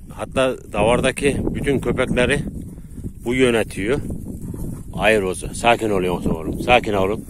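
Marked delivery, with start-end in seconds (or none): 4.9-7.2 soothing the dog. Real, not acted. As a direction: settle the dog down gently. Calming his dog down.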